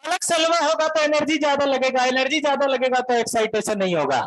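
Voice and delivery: heated Bihari voice arguing with the teacher; agitated Bihari student. Retold or imitated. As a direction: protest like a worked-up Bihari student. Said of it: "तो काहे चिल्ला रहे इतना".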